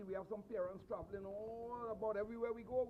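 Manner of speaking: ordinary talk, nothing marked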